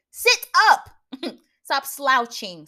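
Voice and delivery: sudden high, loud order; barked command. Acted. Scolding a slouching viewer, sharp high shout. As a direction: snap it like a drill sergeant.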